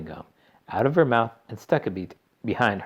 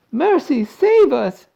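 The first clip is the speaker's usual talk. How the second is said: high, loud cry for mercy; panicked yell; 10 dB louder, slower than usual. A narrator's reading. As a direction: yell out in horror. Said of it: "yelled Missus Beauregard".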